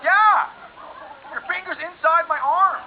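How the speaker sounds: high, loud yell, then drawn out; exasperated, hammed-up panic; freaked-out retort. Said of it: "I'm freaking out".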